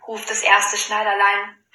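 ordinary delivery

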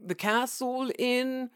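high, gushing mock TV presenter; overexcited tour host, rising loud; tabloid tv host. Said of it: "like, going".

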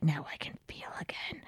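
mock-tragic whisper, very soft; melodramatic whisper. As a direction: whisper it like an overwrought heroine. Heroine bleeding, melodramatic retold line.

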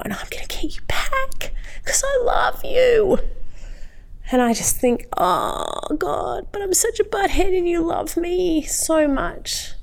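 high, choked-up and overcome; real tearful voice. Real, not acted. Moved by God's love despite being a butthead, high and tearful. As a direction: fight back tears as you speak. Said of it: "Sorry, I didn't mean to cry".

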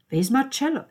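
high, loud question; baffled outburst. Acted. Hearing Marcello's name in the quarrel, loudly baffled. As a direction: cut in, demanding to know.

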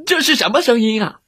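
high, alarmed question; frightened. A narrator's reading. Wolf scared by the horn, high alarmed cry. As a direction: cry out, startled by a noise. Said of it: "这可吓坏了大嘴狼".

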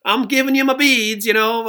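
light mock Louisiana twang, high; little Southern twang. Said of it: "she has her little twang".

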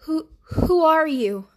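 quavering, frightened woman's voice; high, slow and trembling. A narrator's reading. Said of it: "a quavering woman's voice".

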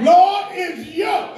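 high, slow, fervent cry; from the heart. Shown as a demonstration. Urging Mother Pat to say it from her heart, high fervent cry. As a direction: cry it out slowly, pitched right up. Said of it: "but say it from your heart".

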